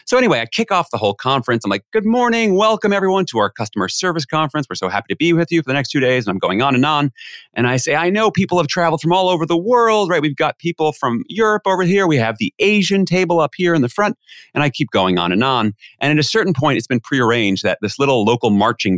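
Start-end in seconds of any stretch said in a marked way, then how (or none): 1.9-6.3 bright-pitched, high conference host welcome; high energy MC voice. Retold or imitated. MC welcoming everyone to a customer service conference, high energy. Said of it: "in my high energy M C voice". 7.9-14.2 high-pitched host addressing the crowd; high energy MC voice. Retold or imitated. MC pointing out tables to the conference crowd, high energy. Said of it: "in my high energy M C voice".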